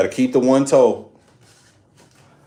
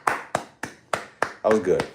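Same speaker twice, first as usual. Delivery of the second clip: slow savoring praise; 3 semitones lower, 5 dB quieter, much slower than usual. Shown as a demonstration.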